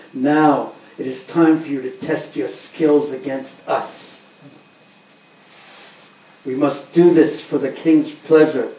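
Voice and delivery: loud, challenging wrestler's boast; booming wrestler. Acted. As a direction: boom it out like a proud wrestler. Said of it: "Chanora started saying".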